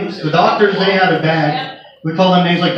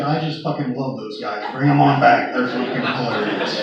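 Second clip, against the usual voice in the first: laughing doctor; 5 semitones lower, 4 dB quieter than usual. Retold or imitated. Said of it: "he came back laughing and said".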